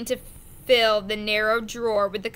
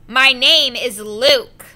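slow, louder, insistent; firm correction; 10 dB louder, slower than usual. A narrator's reading. Luke corrects the mate about his name, slow and firm. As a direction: set him straight, each word firm.

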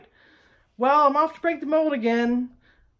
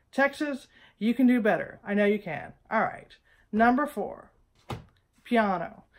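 normal talking voice

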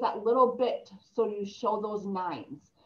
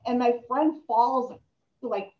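ordinary talk, nothing marked

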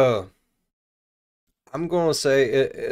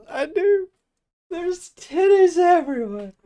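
excited; 14 semitones higher, 4 dB louder than usual. Real, not acted. Giddy over pirate joke.

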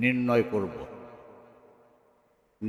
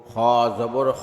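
final "খ" cut short; quick short vowel. Shown as a demonstration. Teacher demonstrating normal jabar, short "খ". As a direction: keep the last "খ" brief, not held. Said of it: "তাড়াতাড়ি করতে হয়".

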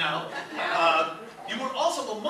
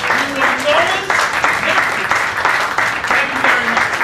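louder and higher, big booming stage close; showman finale; 5 semitones higher, 12 dB louder than usual. Acted. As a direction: rouse the crowd to applaud. Stage psychic wraps up act.

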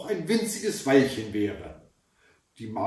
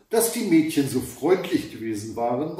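ordinary delivery, nothing marked

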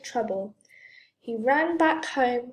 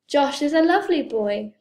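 loud, higher-pitched amazement; amazed mum, voice raised; 4 semitones higher, 6 dB louder than usual. A narrator's reading. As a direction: protest in sheer astonishment.

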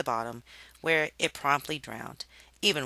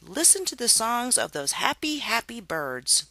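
high, dreamy and slowing at the end; gushing Harriet; 8 semitones higher than usual. A narrator's reading. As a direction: swoon over the birds, drawing it out. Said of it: "she cried skyward".

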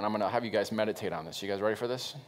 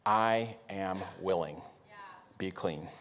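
tender reading; 5 semitones lower, 4 dB quieter, slower than usual. Shown as a demonstration. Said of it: "hear what Jesus responded".

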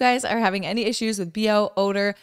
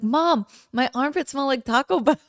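younger self to mom; 6 semitones higher, 3 dB louder than usual. Retold or imitated. Retells telling mom as a child.

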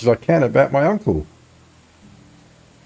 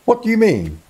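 NPC voice; 9 semitones higher than usual. Acted. NPC voice, player switches voice.

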